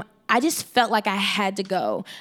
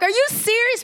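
higher, thrilled question; excited disbelief; 12 semitones higher than usual. Retold or imitated. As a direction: ask in happy disbelief. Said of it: "I was so freaking excited".

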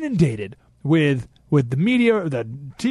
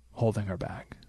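whisper; 10 semitones lower, 12 dB quieter than usual. Real, not acted. Host to caller Daniel, whispering the threat. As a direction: drop to a soft ominous whisper.

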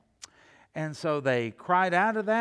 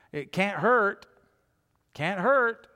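higher, louder, offhand "can't hurt"; shrugging it off; 5 semitones higher, 5 dB louder, slower than usual. Retold or imitated. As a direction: toss it off like a half-hearted gamble. Voicing shrugging sailors.

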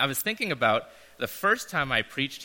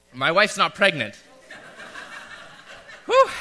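laughing; 7 semitones higher, 7 dB louder than usual. Real, not acted. Joke about pregnancy, laughing.

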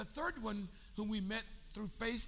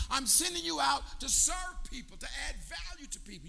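preaching; 5 semitones higher than usual. Real, not acted. Preaching at full force.

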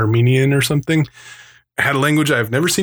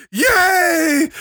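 shouted yay. Retold or imitated. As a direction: yell it up high with joy. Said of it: "And I went".

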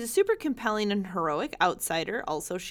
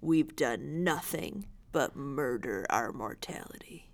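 James Earl Jones; 5 semitones lower than usual. Retold or imitated. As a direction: sink into a low, grave oration, fading to a breath. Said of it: "And I have to do James Earl Jones".

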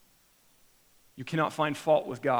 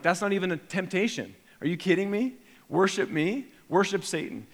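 incredulous scoff; 6 semitones higher than usual. Retold or imitated. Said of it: "I'm thinking, like".

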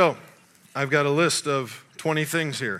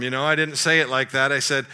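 usual speaking voice

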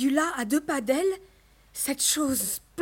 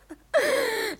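high, derisive fake laugh; mocking laugh; 10 semitones higher than usual. A narrator's reading. Mother ridiculing daughter's wish for a ball, mocking laugh.

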